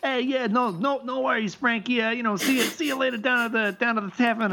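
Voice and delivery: high-pitched New York teamster; poor New York accent. Acted. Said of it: "really poor New York accents".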